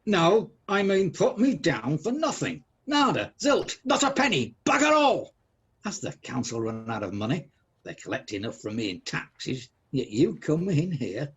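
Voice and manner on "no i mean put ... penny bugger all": high, slow, rising to a loud shout; emphatic angry refusal. Acted. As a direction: hammer out each refusal, building in force. Scrooge refuses the charity collector.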